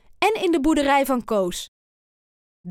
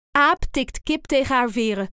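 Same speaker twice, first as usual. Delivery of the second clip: usual speaking voice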